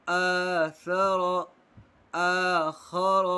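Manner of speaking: आदम with the first आ held long; slowly drawn out. Shown as a demonstration. Teacher drawing out आदम for the class. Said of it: "खींचकर पढ़ना है".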